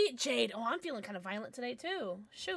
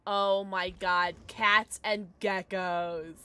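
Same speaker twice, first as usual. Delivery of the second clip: usual speaking voice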